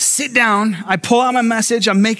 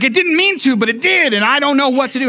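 mock panic, pitched up; panicking child; 5 semitones higher than usual. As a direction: imitate a flustered, panicking kid. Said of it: "he's panicking".

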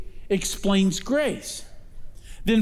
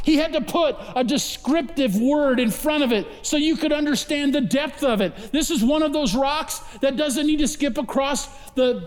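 a touch higher, earnest sermon tone; lightly raised preaching; 5 semitones higher than usual. Acted. Pastor explaining the word so, in lightly raised preaching. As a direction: press the point home to the flock.

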